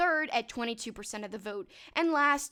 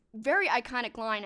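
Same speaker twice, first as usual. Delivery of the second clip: normal talking voice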